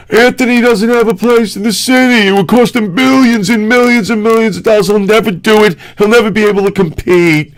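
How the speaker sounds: high-pitched mimicking of a whiny doubter; mocked naysayer. Mocking critics of Anthony's studio plans, high naysayer voice. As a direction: parrot the critics in a high, put-on voice.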